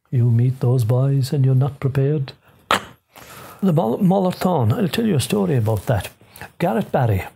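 ordinary delivery, nothing marked

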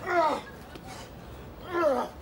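high, effortful grunts; straining groans. Acted. Rescuer strains to drag a man out, groaning high.

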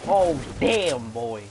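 loud, startled outburst; surprised exclamation. Real, not acted. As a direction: blurt it out in shock. Gamer reacting to a play.

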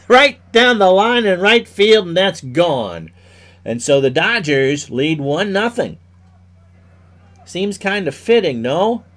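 baseball announcer calling the action; play-by-play. Acted. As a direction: call each pitch like live radio. Live play-by-play of baseball game.

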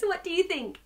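ordinary delivery

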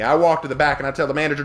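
usual speaking voice

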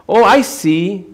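high, airheaded take on a verse; naive casual reader. Acted. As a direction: read it high, as if it's merely nice. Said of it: "I read those verses and I think".

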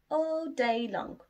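usual speaking voice

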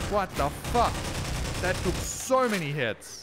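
loud, high-pitched incredulous outburst; exasperated disbelief. Real, not acted. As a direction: protest loudly at the unfair game. Enemy took too many hits.

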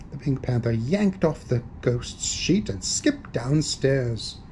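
ordinary delivery

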